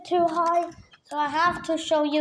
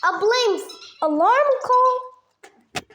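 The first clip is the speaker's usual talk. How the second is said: surprised; 9 semitones higher, 3 dB louder, much slower than usual. Real, not acted. Surprised at alarm call.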